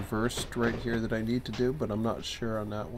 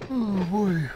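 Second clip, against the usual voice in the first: spoken through a yawn; words stretched by a yawn; 9 semitones higher, 6 dB louder than usual. Real, not acted. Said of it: "I shouldn't be yawning".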